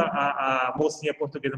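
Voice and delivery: ordinary talk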